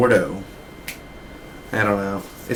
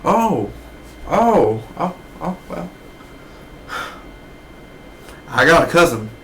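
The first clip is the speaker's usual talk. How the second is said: put-on higher, fumbling old man backpedalling; imitating Bosworth; 5 semitones higher than usual. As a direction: stammer out the oh's, then save face. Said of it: "he was literally like".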